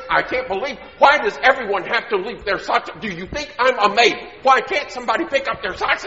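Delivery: loud, high mock rant about socks; hammed-up exasperated nagging; nagging mom. Mom nagging her family about socks, mock loud rant.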